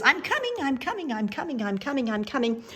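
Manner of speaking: high, loud, tumbling repeats; excited hurrying. Acted. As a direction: call out in a rush, starting high and easing down. Bear hurrying to catch up.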